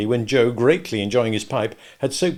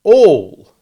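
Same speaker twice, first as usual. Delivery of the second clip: All punched hard and loud; All, said with great emphasis, much louder and higher; emphatic; 8 semitones higher, 16 dB louder than usual. A narrator's reading. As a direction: land All with full weight. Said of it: "very emphatically".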